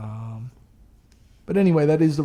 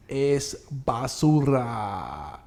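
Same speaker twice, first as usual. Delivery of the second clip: Spanish; much slower than usual. Pretend. Spanish verdict on cards.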